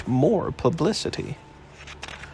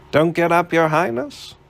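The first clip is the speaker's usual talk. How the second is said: lightly voiced jaunty reporter, a bit higher; cheeky reporter; 6 semitones higher, 3 dB louder than usual. A narrator's reading. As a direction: breeze in with mock politeness. Malone voiced, breezy.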